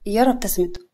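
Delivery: ordinary delivery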